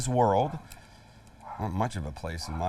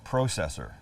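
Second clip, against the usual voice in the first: lower, lightly Canadian "Processor" with a long O; Canadian accent; 6 semitones lower, 4 dB quieter than usual. Shown as a demonstration. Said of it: "in my best Canadian accent".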